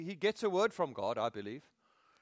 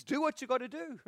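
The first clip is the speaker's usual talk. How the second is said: higher mock nudge; God urging; 10 semitones higher than usual. God pushes Moses to act, higher and half-acted.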